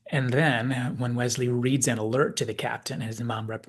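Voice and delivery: ordinary talk, nothing marked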